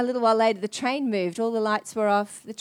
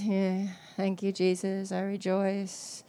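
half-hearted rejoicing; 3 semitones lower, 6 dB quieter, slower than usual. Retold or imitated. Half-hearted reply to the Lord.

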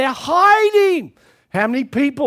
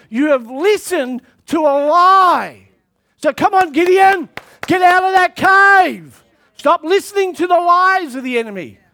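louder, higher urgent call; preaching at full force; 4 semitones higher, 4 dB louder than usual. Acted. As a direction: shout it out like calling him from the cave. Preacher calling Gideon out.

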